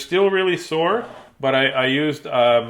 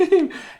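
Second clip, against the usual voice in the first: mocking high laugh. Shopkeeper laughs.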